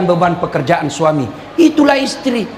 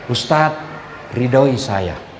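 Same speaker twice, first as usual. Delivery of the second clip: soft, slow, lower pleading; tender plea; 8 semitones lower, 4 dB quieter, much slower than usual. Retold or imitated. Wife asking her husband for his blessing before he leaves, soft and tender. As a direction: murmur it gently, asking for a blessing.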